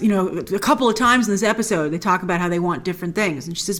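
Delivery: usual speaking voice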